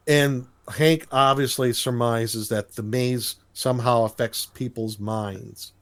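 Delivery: ordinary talk, nothing marked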